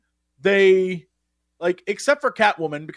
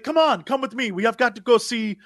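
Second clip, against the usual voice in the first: lightly put-on accent, a bit higher; Storm's accent; 4 semitones higher than usual. Storm hurrying the team along, light put-on accent. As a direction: urge them along in a hint of Storm's accent. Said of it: "why Storm has an accent".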